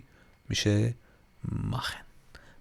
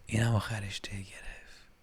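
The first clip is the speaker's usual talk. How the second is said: playful whisper; hushed, voiceless puzzling; very quiet voice; 2 semitones lower, 12 dB quieter than usual. Pretend. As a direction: whisper it, barely audible.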